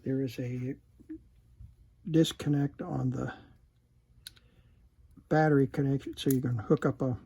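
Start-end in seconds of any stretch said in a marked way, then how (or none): none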